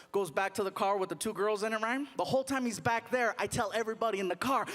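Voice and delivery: usual speaking voice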